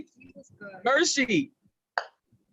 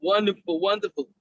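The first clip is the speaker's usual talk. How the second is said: usual speaking voice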